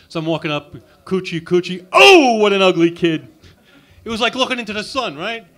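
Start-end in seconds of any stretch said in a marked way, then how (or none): 1.1-3.3 coochie coo then loud slow horror; coo, then recoil loudly; baby talk to disgust. Retold or imitated.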